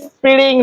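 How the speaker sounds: স্প্রিং split as is-pi-ring; স্প্রিং in slow separate syllables; wrong slow pronunciation. Shown as a demonstration. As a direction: drag স্প্রিং out with an extra vowel after p. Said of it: "এভাবে ধীরে ধীরে নয়".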